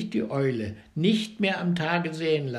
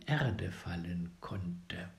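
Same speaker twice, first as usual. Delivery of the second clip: whisper; 9 semitones lower, 9 dB quieter, slower than usual. A narrator's reading. Narration dropping to whisper.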